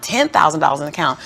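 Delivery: normal talking voice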